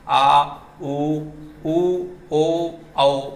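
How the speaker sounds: slow, drawn-out आ, उ, ओ, औ; vowel demo. Shown as a demonstration. Teacher sounds out back vowels for students, slowly. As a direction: stretch each back vowel for the class. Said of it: "तो ये बात समझ में आ रही है आपको".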